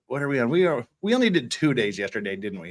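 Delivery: ordinary talk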